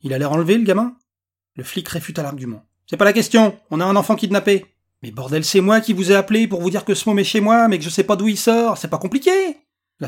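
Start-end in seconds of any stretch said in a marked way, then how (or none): 0.0-1.0 loud retort. A narrator's reading. Policier qui réplique, incrédule. 2.9-9.3 loud, higher-pitched angry argument; exasperated protest. A narrator's reading. Dispute avec les policiers.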